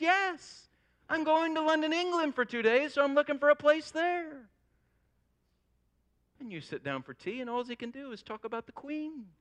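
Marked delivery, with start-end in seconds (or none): 0.0-4.5 high-pitched, eager reply; gushing excitement. Acted. Traveler explains his house sale to a puzzled friend, excited. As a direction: answer as if it were obvious and thrilling.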